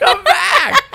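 very high, loud, laughing shout; laughing call. Pretend. Teller joining the joke about the lady, laughing shout. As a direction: laugh it out as a mock plea.

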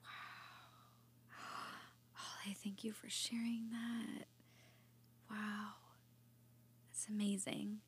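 breathy gasp then hushed thanks; softly stunned by the story; awed whisper. Real, not acted. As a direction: whisper it, moved almost to silence. Moved listener reacts to testimony.

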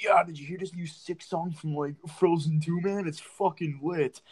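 lightly mocked enthusiast; imitating excited fan. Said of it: "someone's like".

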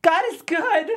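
high, loud cry of relief; loud exclamation. Acted. Jordan after the stunt, a loud high cry. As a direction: shout it out.

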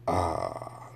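retold whispered sigh, very soft; soft unvoiced ah, damn; dejected whisper. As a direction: breathe it out like bad news just landed. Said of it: "it was like".

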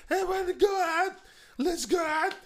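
put-on high whining girl; whiny girl voice. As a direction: whine it like a girl. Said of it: "She's like".

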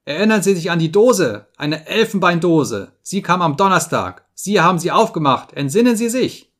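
insistent shouting. A narrator's reading. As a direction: hammer it into a fading listener. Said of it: "schrie er".

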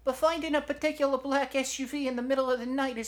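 very high, loud, rapid protest; Nomar. Acted. Shoulder objects to Eugenia's search, high and fast. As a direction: rattle it off loudly in falsetto.

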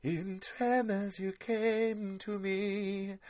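high, very slow operatic phantom voice; Alicia's Lady Phantom voice. Retold or imitated. Doing his co-host's voice as a high, slow female Phantom of the Opera. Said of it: "I'm doing Alicia's voice".

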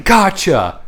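high, triumphant mock yell; acted-out shout, high then falling; yelling back. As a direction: call it back up to him. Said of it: "I yell back saying".

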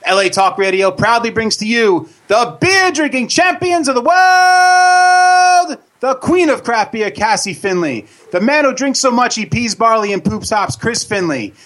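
booming circus ringmaster intro. Acted. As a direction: hype it up like a circus announcer. Radio show opening announcement.

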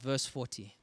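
ordinary delivery

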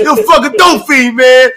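高い叫び声でTの真似; 甲高く怒鳴りつけるように; imitating T's shout. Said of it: "That's how he would talk to you".

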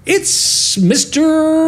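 high, drawn-out silly reveal; hammed-up slow high announcement; radio-play character. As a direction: stretch it out in a high cartoonish voice. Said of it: "Ego Daddy could be like".

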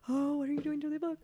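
quick, hammed-up scolding mom; playful mock alarm at the kids, fast; mock fussing parent. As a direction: fret over the book, half joking. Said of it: "I don't have to be all like".

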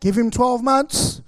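loud slow hey steady on; mock warning. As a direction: rein the girls in, half joking. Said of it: "And I'd say".

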